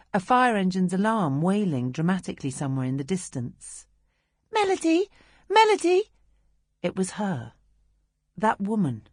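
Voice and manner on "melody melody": high desperate calling of a name; frantic mother shouting for her child. Acted. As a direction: call her daughter's name in panic.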